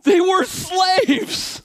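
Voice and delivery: high, loud, very slow shout of "They were slaves". Real, not acted. Preacher shouts the point at the congregation. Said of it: "emphatic repeat".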